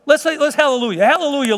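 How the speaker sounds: high-pitched hallelujah call; lifted, jubilant church shout; exultant praise. Real, not acted. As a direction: lead the congregation in heartfelt praise. Calls congregation to praise God.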